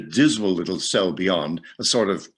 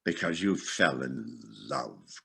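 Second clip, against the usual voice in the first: low, much softer, slow growl; 4 semitones lower, 9 dB quieter than usual. A narrator's reading. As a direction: growl it back with contempt. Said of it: "growled Scrooge".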